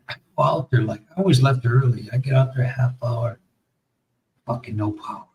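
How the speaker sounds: ordinary talk, nothing marked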